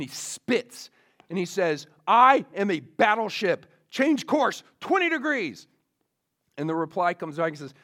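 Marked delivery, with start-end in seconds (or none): 2.1-5.7 loud, much higher, indignant command; furious captain. Retold or imitated. Furious captain ordering the other ship aside, loud and indignant. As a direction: pull rank in acted fury. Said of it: "now the captain is furious".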